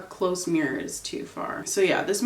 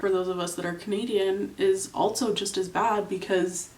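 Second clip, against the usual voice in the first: ordinary talk, nothing marked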